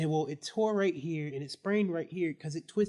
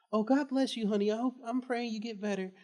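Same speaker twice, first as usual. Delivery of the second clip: higher, doting blessing in a lightly voiced old lady; sweet old lady; 6 semitones higher than usual. Student lightly imitating the substitute teacher's blessing. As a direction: mildly mimic a churchy sweet lady.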